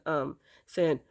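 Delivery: ordinary talk, nothing marked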